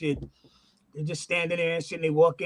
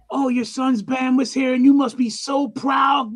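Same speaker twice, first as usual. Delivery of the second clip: hammed-up admiration, pitched up; gushing fan; 4 semitones higher, 5 dB louder than usual. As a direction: imitate an overexcited fan praising someone's child. Mimics gushing fans after the show.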